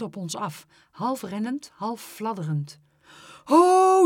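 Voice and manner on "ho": loud, high cry of alarm; sudden high-pitched holler; urgent shout. A narrator's reading. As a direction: shout to halt everyone. Teacher calls out as swan charges.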